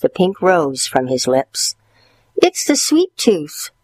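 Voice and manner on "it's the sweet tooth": put-on boy voice. Stuart voiced high.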